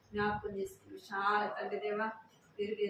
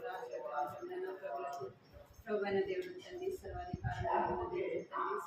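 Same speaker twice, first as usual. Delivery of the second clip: quick, soft, high-pitched praying; fervent prayer; 9 semitones higher, 6 dB quieter than usual. Real, not acted. Leader praying fervently over the children. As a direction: pour out the prayer fast.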